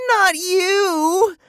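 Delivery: very high, loud squeal. A narrator's reading. Girl stopping the robot copying her, high squeal. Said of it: "Eva squealed".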